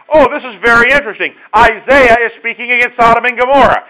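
loud, high excited musing; naive listener. Pretend. Preacher voicing the congregation's first naive thought, loud high eager. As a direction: play an eager reader who missed the point. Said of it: "You may have gotten the idea".

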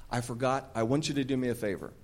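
ordinary talk, nothing marked

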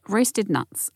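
usual speaking voice

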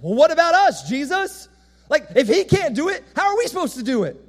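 high-pitched, loud mock protest; alarmed bystanders. Everybody around asks Jesus about themselves, high-pitched protest. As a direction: plead in hammed-up disbelief.

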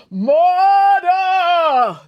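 long, loud, high drawn-out bellow; sonorous bellow. A narrator's reading. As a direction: bellow it like a ghost, holding the vowels. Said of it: "sonorous voice bellowed out from behind them".

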